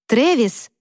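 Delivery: calling into the dark. Acted. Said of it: "крикнула в пустоту".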